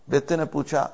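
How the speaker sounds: normal talking voice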